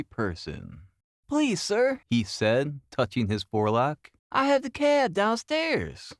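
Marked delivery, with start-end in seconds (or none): 1.3-2.0 put-on a young street urchin, raised high and eager; urchin boy voice. A narrator's reading. Street boy Wiggins reports to Holmes. 4.3-6.1 put-on a young street urchin, raised high and eager; urchin boy voice. A narrator's reading. Street boy Wiggins reports to Holmes.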